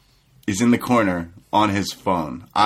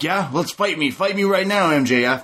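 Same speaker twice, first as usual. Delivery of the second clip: higher hammed-up challenge; lightly voiced eager DDP; mock DDP; 8 semitones higher than usual. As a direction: dare him with mock bravado. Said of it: "D D P's like".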